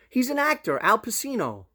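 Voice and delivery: high, loud mock-daughter explaining; daughter's voice. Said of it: "She goes".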